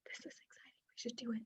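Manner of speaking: barely audible whisper; the spleen whispering its yes. Retold or imitated. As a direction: breathe it like a secret inner voice. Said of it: "Spleen tends to whisper".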